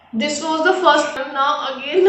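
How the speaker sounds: normal talking voice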